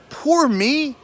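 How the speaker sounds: high whiny child voice mocking; mock a sulking little kid; whiny poor me. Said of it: "What are you, Macaulay Culkin?".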